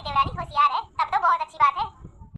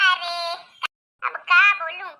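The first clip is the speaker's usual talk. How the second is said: usual speaking voice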